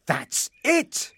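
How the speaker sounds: high, sudden exclamation; excited cry. Acted. Frederick gets his big idea, crying out excitedly. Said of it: "cried Frederick".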